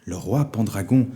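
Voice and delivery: plain reading voice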